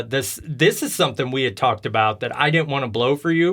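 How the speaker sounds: ordinary talk, nothing marked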